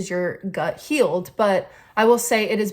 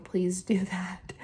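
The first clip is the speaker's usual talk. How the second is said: laughing whisper; 7 dB quieter, slower than usual. Real, not acted. Laughing request after sign-off.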